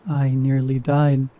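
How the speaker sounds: ordinary delivery, nothing marked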